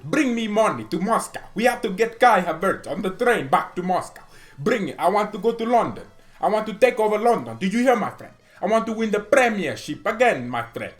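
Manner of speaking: a hammed-up Russian boss demanding money and players; playful Russian tycoon voice, loud and bossy; Roman Abramovich. Voicing Abramovich giving orders.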